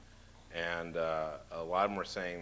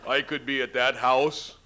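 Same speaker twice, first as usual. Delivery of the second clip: higher, louder, slow boastful governor voice; imitating Jesse Ventura; 4 semitones higher, 7 dB louder than usual. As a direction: swagger through it like the wrestler governor. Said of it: "he said something about".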